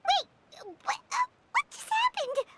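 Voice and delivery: high, flustered double take; baffled. Acted. Paimon thrown by Miko turning her words around, a high flustered question. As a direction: stumble over your own confusion.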